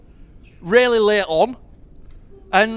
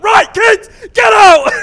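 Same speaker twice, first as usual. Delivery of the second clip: retold shout; 10 semitones higher, 13 dB louder, much slower than usual. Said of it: "Tom's like".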